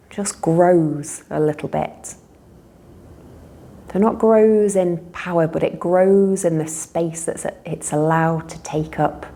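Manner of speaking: calm guided relaxation with long pauses; guided meditation. Real, not acted. Teacher leading listeners in a body-awareness relaxation, unhurried meditation voice. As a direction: guide them gently through noticing breath and body.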